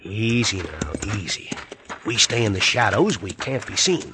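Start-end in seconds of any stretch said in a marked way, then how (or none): 0.0-1.5 soothing. Acted. Calming horses in the dark.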